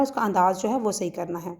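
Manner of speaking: normal talking voice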